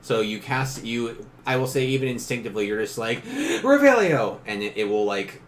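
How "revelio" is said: high, loud, drawn-out incantation; shouted spell. Pretend. Player belts out the spell name, shouted high and loud.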